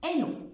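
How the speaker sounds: elles ont with no z, el ont; no liaison. Shown as a demonstration. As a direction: leave the gap between elles and ont. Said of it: "ما بقولش". (elles ont من غير ربط)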